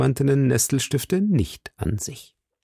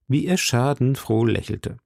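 plain reading voice

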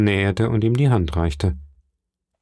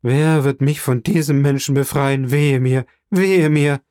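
loud, raised-pitch cry of despair; frantic lament; 5 semitones higher, 4 dB louder than usual. A narrator's reading. Running man begs to be rid of rival, frantic lament. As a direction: wail it out, building on the woe. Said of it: "wie ein Wahnsinniger fortrennend".

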